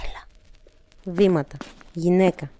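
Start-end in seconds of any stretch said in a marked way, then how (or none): 0.1-0.3 whispered script line; whispering. A narrator's reading. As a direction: read it in a whisper. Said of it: "ψιθυρίζοντας". (ψιθυριστά)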